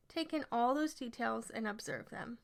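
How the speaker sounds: usual speaking voice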